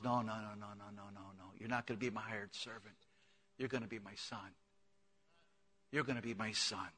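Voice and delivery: soft, hushed fatherly reassurance; tender father. Acted. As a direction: gently refuse him and embrace him as son. God the Father voiced tenderly.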